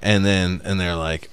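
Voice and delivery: ordinary talk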